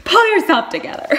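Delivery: high, loud pep talk to herself; mock self-scolding. Reader welling up orders herself to toughen up, mock self-scolding. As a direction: snap yourself out of it, loudly.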